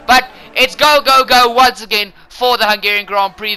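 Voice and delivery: race start call. Real, not acted. Commentator calls the race start to viewers, excited shout. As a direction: yell the start like the lights just went out.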